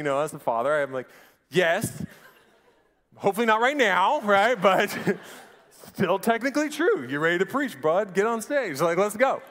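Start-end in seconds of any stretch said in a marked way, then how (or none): 1.5-9.4 higher, louder, laughing through it; amused dad talking through laughs; giggly excited reply. Real, not acted. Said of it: "laughing father's reply".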